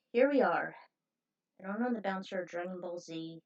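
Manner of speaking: ordinary delivery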